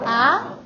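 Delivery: AH rising like a surprised question. Shown as a demonstration. Teacher plays surprised dentist for the class, rising tone. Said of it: "with the second tone".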